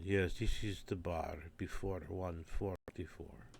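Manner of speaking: put-on slow, quiet Hungarian-accented agreement; soft, slow Hungarian accent; Hungarian conductor accent. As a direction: conductor gravely going along with it. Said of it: "he would go along with it".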